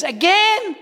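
high, loud, incredulous question; exasperated brother. Retold or imitated. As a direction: shoot it back in loud disbelief. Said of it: "He goes".

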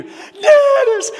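very high, loud, wailing shout; screaming a name. Retold or imitated. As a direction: wail the name out at full voice. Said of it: "screaming his name".

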